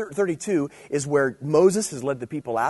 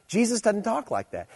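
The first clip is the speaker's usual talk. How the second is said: ordinary talk, nothing marked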